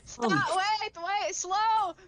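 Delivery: panicked shouting. Acted. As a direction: yell to brake a runaway ship. Player panics as ship launches.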